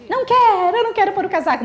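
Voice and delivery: put-on stubborn child refusing the coat; whiny refusing child. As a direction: whine it like a stubborn kid.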